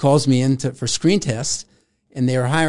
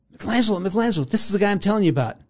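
higher, fast, a lightly voiced keen assistant; eager assistant; 7 semitones higher, much faster than usual. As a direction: rush it out, presenting him proudly. Assistant introduces him to Antonioni.

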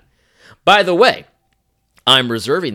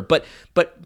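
normal talking voice

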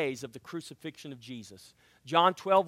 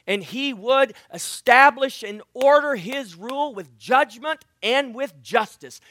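loud, rising preacher's build; preaching at full force; 5 semitones higher, 11 dB louder than usual. Acted. Preacher booming to the church, fervent. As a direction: proclaim it to the congregation.

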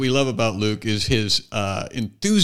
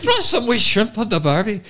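high, hammed-up Irish accent; mock Irish accent; 8 semitones higher than usual. Teasing the guest's Australian attempt, mock Irish accent. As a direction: lilt it like a joke Irishman, pitched up. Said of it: "that was a bit Irish".

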